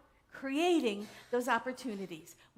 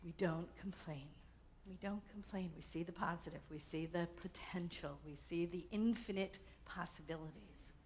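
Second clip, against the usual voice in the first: softer, low prayerful affirmations; 5 semitones lower, 3 dB quieter than usual. Acted. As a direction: murmur them like a quiet creed.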